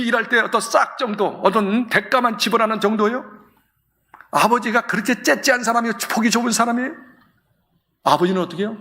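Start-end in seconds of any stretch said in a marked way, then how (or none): none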